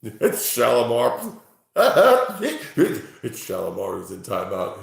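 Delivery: laughing through the words. Real, not acted. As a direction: giggle while saying it. Laughing at chatter name.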